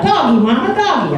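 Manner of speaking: put-on high, wondering little-child voice; a toddler asking his mother about cows, high and curious. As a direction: ask like a three-year-old seeing cows. Imitating three-year-old son.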